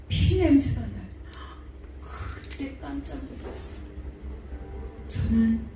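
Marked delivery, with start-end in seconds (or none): 2.0-3.8 breathy hush over the shock. Real, not acted. Preacher tells the congregation of her shock, hushed. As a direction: drop to a whisper, awed.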